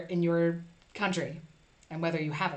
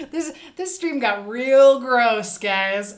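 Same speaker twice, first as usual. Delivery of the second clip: mock disgust; 4 semitones higher, 9 dB louder, much slower than usual. Real, not acted. Mock disgust at the gross stream.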